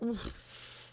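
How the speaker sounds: a soft whispered wince of an oof; whispered oof at an awkward question; wincing oof. Retold or imitated. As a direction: breathe it out like a grimace. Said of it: "And the French are like".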